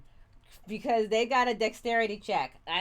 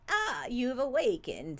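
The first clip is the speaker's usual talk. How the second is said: slow villain voice; 2 semitones higher than usual. Acted. Reads villain scene dialogue.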